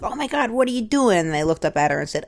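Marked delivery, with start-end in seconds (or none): 0.0-1.2 high-pitched retold panic; mock freak-out. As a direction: imitate a wife freaking out. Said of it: "freaking out".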